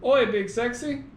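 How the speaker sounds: attempted Aussie accent. Imitating friend's phone assistant, in a hammy Aussie accent. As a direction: put on a bad Australian accent. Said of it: "how does she say it?".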